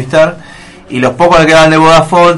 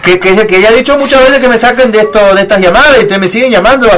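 higher mock-Castilian rant; high, indignant Spain accent; angry Spanish customer; 7 semitones higher, 4 dB louder than usual. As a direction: imitate a fed-up Spaniard on a sales call. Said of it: "la mayoría me decía".

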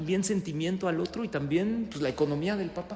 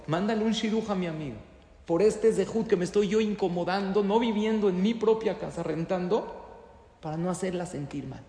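earnest prayer; 4 semitones higher, 4 dB louder than usual. Acted. Bride prays to Hashem.

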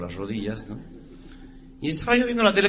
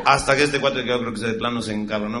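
ordinary talk

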